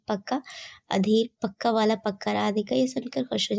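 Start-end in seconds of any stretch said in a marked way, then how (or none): none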